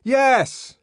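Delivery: shouted no; loud flat refusal. A narrator's reading. The savage refusing Bernard, a loud shouted no. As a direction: slam the answer back.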